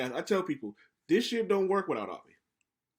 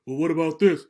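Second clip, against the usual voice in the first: louder, a hint of [name] grasping at straws; mock [name]; 10 dB louder than usual. Host mimicking [name] arguing with Candace, louder mock voice. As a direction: push the question out loud and stubborn. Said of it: "And he goes".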